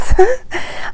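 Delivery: short high-pitched giggle. A narrator's reading. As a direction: give a quick, high giggle. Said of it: "Minnie giggles".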